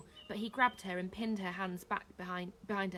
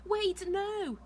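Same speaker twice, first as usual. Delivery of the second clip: high, loud cry; urgent protest; 12 semitones higher, 6 dB louder than usual. A narrator's reading. Chaya pulling herself away, crying out in protest. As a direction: protest as you pull free.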